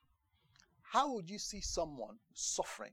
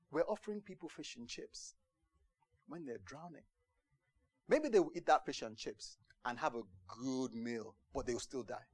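usual speaking voice

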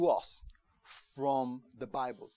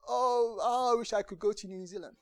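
almost in tears; 7 semitones higher, 5 dB louder than usual. Retold or imitated. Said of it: "almost in tears".

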